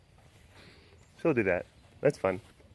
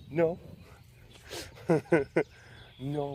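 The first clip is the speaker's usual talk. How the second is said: normal talking voice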